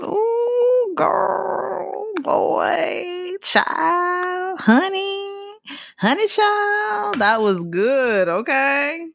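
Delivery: high, drawn-out, slowing exclamations; over-the-top exclamations. Real, not acted. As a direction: stretch each word way up high. Raving about how good the hit was.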